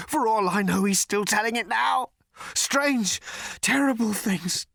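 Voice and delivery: high, savage ranting. A narrator's reading. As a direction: rant savagely, working up towards a scream. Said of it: "said the man savagely".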